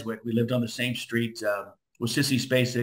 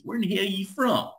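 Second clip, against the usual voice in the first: mock angry caller; 8 semitones higher than usual. Irate caller phones the station.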